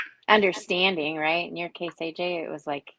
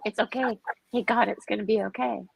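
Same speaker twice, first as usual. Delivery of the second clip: higher, reassuring and tender; comforting God; 5 semitones higher than usual. Retold or imitated. A woman reassuring a sad God, higher and tender. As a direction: soothe him like a friend who is hurting. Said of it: "to sit in this and go".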